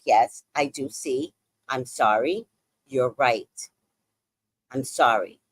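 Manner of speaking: low, deliberate admission of guilt; contrite concession. Retold or imitated. Comic admitting to Tony she's right, low and contrite. Meekly conceding to roommate at night.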